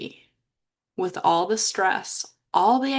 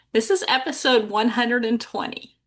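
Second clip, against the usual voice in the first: usual speaking voice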